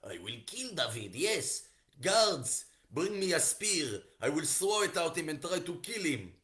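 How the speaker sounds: loud, high hammed-up rage in accented English; building acted anger, rising to a shout; angry Shaul. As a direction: order the guards in theatrical fury. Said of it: "Shaul was so angry".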